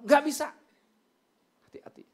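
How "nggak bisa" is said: high, loud mock refusal; curt refusal. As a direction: shut down the plea as an acted husband. Husband refuses wife's plea.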